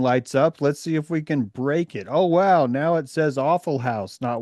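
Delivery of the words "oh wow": ordinary talk, nothing marked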